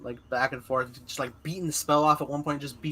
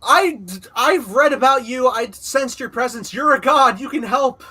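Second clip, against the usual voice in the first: high, loud desperate pleading; desperate plea; 8 semitones higher, 8 dB louder than usual. Retold or imitated. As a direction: beg the god for help. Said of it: "he's just like".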